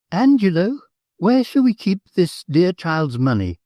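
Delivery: a hint of a high, fussy countess; lightly voiced Countess calling her husband. A narrator's reading.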